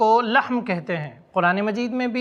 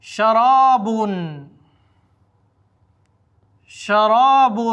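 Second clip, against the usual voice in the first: شراب called out loudly and clearly; loud word demo; 4 dB louder, much slower than usual. Shown as a demonstration. Teacher to students calling out the word loudly. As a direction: announce شراب loudly for the class. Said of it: "آپ بھی دہرائیں ساتھ میں".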